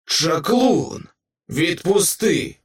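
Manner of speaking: high, drawn-out monster demand; lightly voiced creature rasping an order. A narrator's reading. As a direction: rasp it out high, like a trapped monster. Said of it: "прохрипіла істота".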